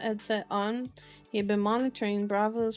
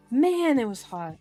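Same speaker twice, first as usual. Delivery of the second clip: louder, with a big swell on Man; raised reading voice; 4 semitones higher, 9 dB louder than usual. Real, not acted. Reader restarting after music drowned them out, raised reading voice. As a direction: read it out over loud music, leaning on Man. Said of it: "Couldn't hear myself read".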